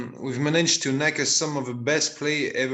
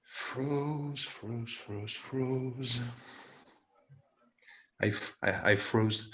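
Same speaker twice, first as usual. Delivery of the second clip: low-key, hushed repetition, much softer; quiet, haunted recall; 4 semitones lower, 7 dB quieter, much slower than usual. Acted. Member recalling a flopped performance, quietly haunted. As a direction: repeat it softly, as if reliving it.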